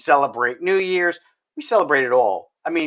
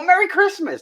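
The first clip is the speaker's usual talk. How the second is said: Merry Christmas sung out much higher; higher, excited Merry Christmas back; 12 semitones higher than usual. Retold or imitated. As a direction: greet back with a burst of joy. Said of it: "they get excited too".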